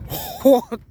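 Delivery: high excited squeal. Real, not acted. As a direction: squeal the word hot way up high. Exclaiming about the Malta heat.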